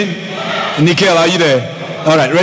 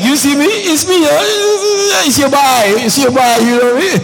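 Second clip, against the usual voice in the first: high-pitched, squealing delight; excited greeting; 11 semitones higher than usual. Real, not acted. Greets an old acquaintance, delighted, joking.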